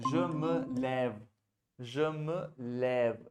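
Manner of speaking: je me lève said slowly, syllable by syllable; slow model reading. Shown as a demonstration. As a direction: stretch out je me lève for the learner. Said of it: "wherever you would see".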